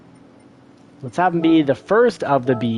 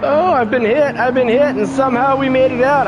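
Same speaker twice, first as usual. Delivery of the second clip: excited; 10 semitones higher than usual. Real, not acted. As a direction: blurt it out, rattled but relieved. Just got hit in race.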